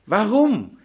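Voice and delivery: ordinary delivery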